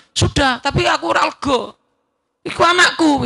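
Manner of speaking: put-on a mother crying out that the child is hers; upset protest. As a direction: cry out that it is your child.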